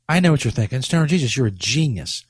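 normal talking voice

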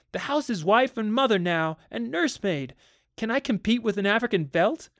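high, urgent, overwrought pleading; distressed outburst. A narrator's reading. Wife feels replaced by the house, high and distressed. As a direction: pour it out with rising desperation.

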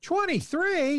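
high, slow amazed question; surprised. Real, not acted. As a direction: sound amazed at the number. Amazed at the count.

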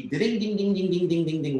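quick run of mimicked ringtone sounds. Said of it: "this ringtone character".

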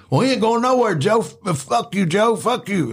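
imitating crackheads cursing Joe. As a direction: jeer at Joe from the corner. Crackheads shouting back.

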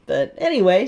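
ordinary talk

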